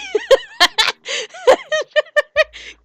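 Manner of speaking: high, loud burst of laughter; loud laughing fit. Real, not acted. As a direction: crack up at your own joke. Cracking up at own joke.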